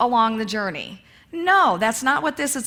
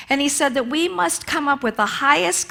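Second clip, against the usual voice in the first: ordinary delivery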